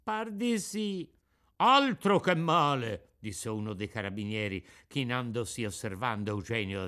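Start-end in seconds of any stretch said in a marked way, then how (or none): 0.0-1.1 high, slow, meek reply; barely dares to answer; timid puppet. A narrator's reading. Pinocchio answers the officer, meek and high. 1.6-3.2 slow, grave official voice; a carabiniere weighs the wound; stern policeman. A narrator's reading. The carabiniere examines Eugenio, grave and slow.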